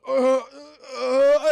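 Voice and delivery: high, loud pained groans; hammed-up groaning of the wounded; mock dying groans. Speared woman dying.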